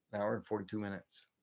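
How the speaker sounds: normal talking voice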